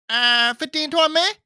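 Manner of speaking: imitating a Chinese restaurant worker, high rising; a rough, high-pitched Chinese-accent take on the phone. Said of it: "I can't do the accent".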